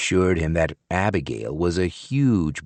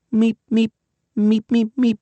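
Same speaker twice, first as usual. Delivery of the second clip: whispered meeps. A narrator's reading. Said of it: "She whispered".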